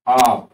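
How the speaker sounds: আপ said loud with the a drawn out long; stretched vowel. Shown as a demonstration. Teacher stretching the vowel in আপ for students. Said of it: "এই আটাকে আমরা টেনে উচ্চারণ করেছি".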